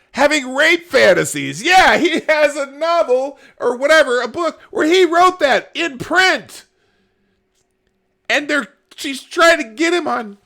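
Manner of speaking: high, loud, incredulous outburst; exasperated rant. Real, not acted. Host astonished no campaign attacked Bernie, exasperated. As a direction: shout it in sheer disbelief.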